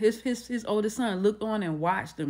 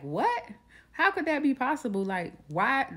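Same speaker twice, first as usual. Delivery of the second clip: higher, incredulous retold question; retold disbelief, higher; shocked disbelief; 6 semitones higher than usual. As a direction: voice the family's disbelief, pitch up. Family hears shocking DNA news.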